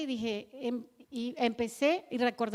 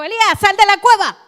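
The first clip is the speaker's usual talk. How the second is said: a harsh shout, much higher and louder; 8 semitones higher, 21 dB louder than usual. Shown as a demonstration. God yelling at Elijah, a demonstrated harsh shout. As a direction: bark it out like an angry command. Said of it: "¿Le gritó así?".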